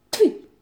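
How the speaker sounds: sharp high spitting sound; a scornful "pah!"; contemptuous spit. Acted. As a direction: spit at him in disgust. Spitting at groveling ex.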